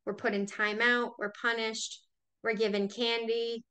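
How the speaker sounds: ordinary delivery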